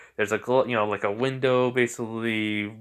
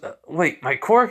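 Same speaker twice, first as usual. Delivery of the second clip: rising high, louder disbelief; astonished question; 5 semitones higher, 5 dB louder than usual. Acted. Boy hearing he has a quirk, astonished. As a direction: climb in pitch on the question.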